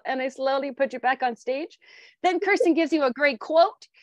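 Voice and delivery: ordinary talk, nothing marked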